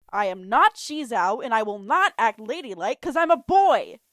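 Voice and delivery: put-on boy loudly refusing to be a lady; insisting. A narrator's reading. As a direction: insist hard, each word firm. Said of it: "Guy insisted".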